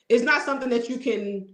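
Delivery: normal talking voice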